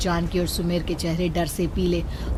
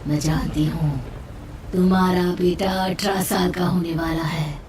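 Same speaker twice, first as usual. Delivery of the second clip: menacing ghostly voice, whispering then booming louder; eerie witch voice; 2 semitones higher, 5 dB louder than usual. Acted. A witch's voice on the phone threatens the father. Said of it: "एक बेहद डराने वाली आवाज".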